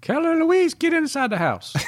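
high farmhouse granny calling out; great grandma voice. Acted. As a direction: call the kid in like an old farm granny. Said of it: "Great, great grandma voice?".

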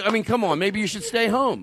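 ordinary delivery

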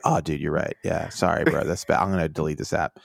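mock bro agreeing, low and quiet; a hint of a stoned Scott Disick. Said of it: "He'd be like".

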